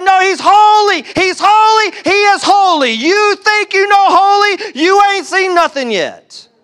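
high, loud, exultant proclaiming; full-voiced emphatic cry, HOLY hit hard; emphatic angel shout. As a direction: shout it as an awestruck angel insisting to the others. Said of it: "that's how it would be".

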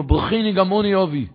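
pleading son. Acted. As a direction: plead for a father's blessing. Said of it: "ואמר".

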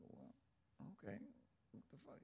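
soft, breathless whispered panic; retold whisper. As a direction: whisper it so the cop can't hear. Reacting to detective at door.

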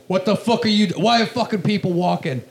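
imitating the old door guy yelling at people coming in the back; angry yell. Door guy chewing out comics, mock angry yell.

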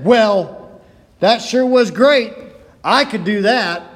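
sarcastic heckle. Retold or imitated. Old man heckling the young preacher, loud and sarcastic. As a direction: needle the preacher with dry scorn.

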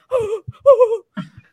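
high, loud mock gasps; hammed-up collective gasping; shocked gasps. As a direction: gasp like a horrified waiting room. People in reception reacting in horror.

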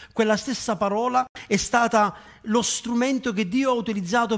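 soaring sermon proclamation; fervent preaching. Acted. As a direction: preach the cross with full force. Preaching climax.

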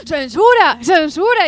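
chanted mock protest; mock outcry. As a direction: shout it like a heckler. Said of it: "y vosotros".

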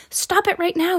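sharp high holler. A narrator's reading. Teacher stopping two fighting kids, high hollering. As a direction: yell to break up the fight. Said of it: "she hollered".

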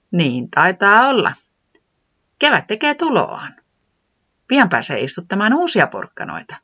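lightly high, smiling voice; Säämies smiling. Acted. Säämies answering Tiputi with a smug smile, lightly high. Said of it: "hymyili maireasti".

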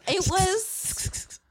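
usual speaking voice

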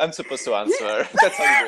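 loud laughing lecture; loud sharp voice. Real, not acted. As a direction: lecture him loudly, half laughing. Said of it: "Why are you yelling at me?".

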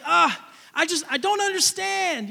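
put-on high, pleading kid's lament; a six-year-old crushed by losing; upset child. Little boy telling mom he doesn't understand, high and pleading.

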